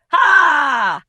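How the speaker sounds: one huge barking laugh, high and loud; shouted ha. Acted. A janitor's booming laugh echoes off the lockers. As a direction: blast a single laugh down the hallway.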